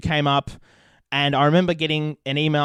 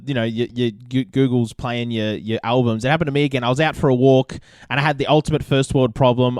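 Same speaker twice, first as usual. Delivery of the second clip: usual speaking voice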